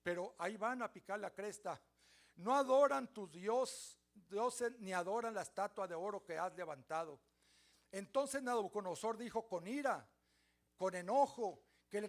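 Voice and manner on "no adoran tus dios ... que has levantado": louder, slowed denunciation; accusing tattlers. Acted. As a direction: stir the king up against them. Accusers denouncing to the king.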